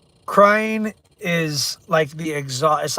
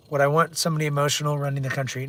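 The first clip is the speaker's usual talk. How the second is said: ordinary talk, nothing marked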